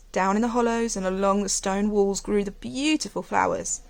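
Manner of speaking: plain reading voice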